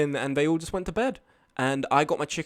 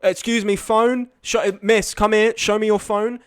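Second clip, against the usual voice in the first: loud, higher barking orders at passengers; demanding bus driver; 6 semitones higher, 8 dB louder than usual. Retold or imitated. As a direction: boss everyone onto the bus. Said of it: "he was really demanding".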